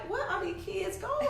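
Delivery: high, laughing, half-whispered disbelief; amazed laughing question. Retold or imitated. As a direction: laugh it out in wonder. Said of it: "I'm like".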